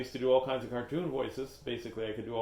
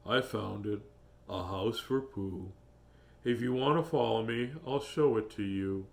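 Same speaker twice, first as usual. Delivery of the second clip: low, slow, mopey Eeyore voice; gloomy donkey; 5 semitones lower than usual. Acted. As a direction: drag it out low like a glum donkey.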